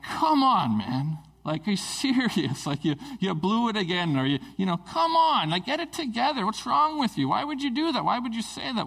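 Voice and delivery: rising exasperation, peaking at "come on" and "what's wrong"; exasperated rant. Retold or imitated. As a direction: vent at someone who let you down again. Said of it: "I remember just kinda thinking like".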